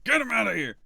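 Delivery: high, loud yelled command; shouted order. Acted. Game master plays the gunman ordering people about, shouting. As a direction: yell it like a rattled gunman. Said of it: "and he shouts".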